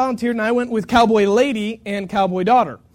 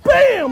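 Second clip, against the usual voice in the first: sudden higher, louder bam of a kick; imitated kick; 12 semitones higher, 7 dB louder than usual. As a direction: smack the word like a hoof hitting. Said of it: "the cow goes like this".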